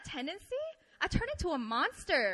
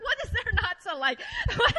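high, louder, laughing mock astonishment; laughing disbelief; 7 semitones higher, 6 dB louder than usual. Preacher joking about being disliked, laughing disbelief. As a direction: laugh through it, climbing on "What".

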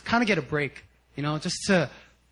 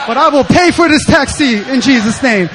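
loud, laughing declaration; half-laughing hammed-up pledge; mock bold vow; 7 semitones higher, 11 dB louder than usual. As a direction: proclaim it big like a faith vow. Acted bold vow to the Lord.

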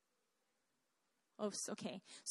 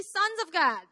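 "Sons of God" raised and louder, as a prompt; leading a group response; 3 semitones higher, 5 dB louder than usual. Shown as a demonstration. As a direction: call out "Sons of God" for everyone to repeat. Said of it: "Everyone say".

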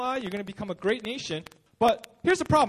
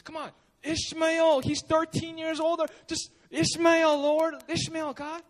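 higher, slow pleading with God; pleading old man; 7 semitones higher, slower than usual. Retold or imitated. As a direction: beg for Ishmael, drawn out and higher.

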